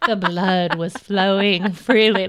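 slow dramatic retelling. Retold or imitated. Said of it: "But you're like".

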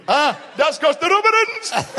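high, loud shouted punchline, slowed; gleeful joker shout. Retold or imitated. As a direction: bellow the old gag with delight. Uncle Jack's yearly punchline.

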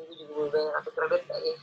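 normal talking voice

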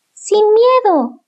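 put-on high eager young owl; high, slow brave owlet. Brother owl encourages.

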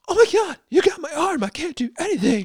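high-pitched mock damsel; hammed-up helpless squeal; helpless girl. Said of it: "and then at other times".

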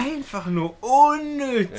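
slow, high, drawn-out complaint; exasperated. Real, not acted. Storyteller judging the whole ordeal, exasperated drawl. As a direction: stretch it out in loud disbelief.

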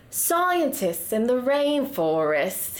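slow, drawn-out Australian accent attempt; bad Australian accent. Shown as a demonstration. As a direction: badly fake an Australian accent. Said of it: "I think I'm the latter".

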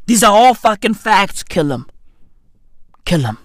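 usual speaking voice

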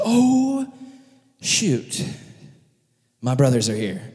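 drawn-out "oh", then hushed realization; startled dismay. Retold or imitated. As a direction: voice a sinking "oh, shoot" moment. Joseph startled seeing his brothers.

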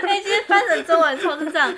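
laughing. Real, not acted. Laughing at translated slogan.

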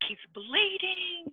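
high, stretched-out mock wail; imitated cry of alarm, slow and high; imitated alarmed mom. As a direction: draw it out high like a worried mother. Acting mother's alarm at injury.

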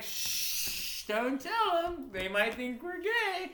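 put-on slow, drawn-out drunken shushing; drunk hushing. Drunk soldier hushing his best friend, slow and secretive. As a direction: shush him like a wasted co-conspirator.

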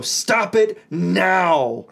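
loud, slow, drawn-out order; angry command. Retold or imitated. Dad ordering the kids to quit, angry. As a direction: lay down the law, each word stretched. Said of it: "I'm so incredibly angry".

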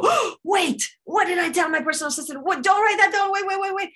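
high, urgent, gabbled alarm; mock panic. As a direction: stop yourself in a hammed-up panic. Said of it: "Then you go".

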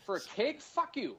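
high indignant outburst; outraged. Retold or imitated. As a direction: spit it out in disbelief. Retold outraged reaction.

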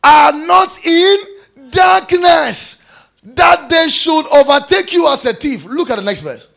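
high, loud proclamation of scripture; fiery pulpit delivery; full-force preaching. Real, not acted. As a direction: drive the verse home in ringing high shouts.

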